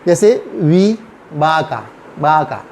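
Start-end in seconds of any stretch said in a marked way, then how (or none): 1.3-2.6 बाका with a soft B, slowly; light B for V. Shown as a demonstration. Teacher showing Spanish V to learners, बाका with light B. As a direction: say बाका starting BAA, lips lightly closed. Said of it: "ये बी की ही तरह pronounce करता है".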